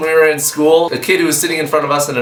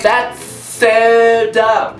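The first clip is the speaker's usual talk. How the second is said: very slow, higher dismissive drawl; mock dismissive; 8 semitones higher, much slower than usual. Committee dismissing the tiger idea, slow mock drawl. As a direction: drawl it out bored. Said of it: "everyone was like".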